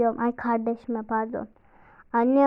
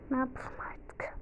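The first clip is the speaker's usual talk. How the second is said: whisper; 12 dB quieter than usual. Real, not acted. Resigned aside.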